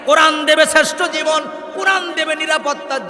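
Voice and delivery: high, loud rhythmic sermon delivery; full-force preaching. Real, not acted. Preacher telling the crowd what the Quran is for, full-force preaching. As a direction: drive each line out like a rallying cry.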